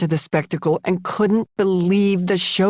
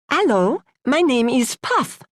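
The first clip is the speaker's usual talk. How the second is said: put-on perky French accent; 8 semitones higher than usual. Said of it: "she said in a perky French accent".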